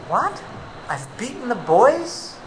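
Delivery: disbelieving reply; shocked husband. Acted.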